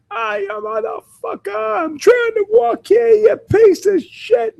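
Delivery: naked man yelling at cars in a mock New York accent. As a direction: yell at drivers like a New Yorker stuck in traffic. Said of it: "not necessarily from Boston, not from New York".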